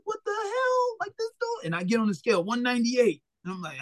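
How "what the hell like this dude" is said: much higher, incredulous mock gasp of disbelief; imitating stunned onlookers. Boxer imitating onlookers at the weigh-in, high incredulous mock disbelief. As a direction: play the crowd staring in disbelief.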